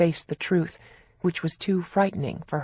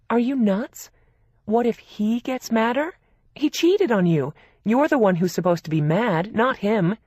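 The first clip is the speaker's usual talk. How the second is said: indignant sister; 6 semitones higher, 5 dB louder than usual. A narrator's reading. Older sister outraged at cheater.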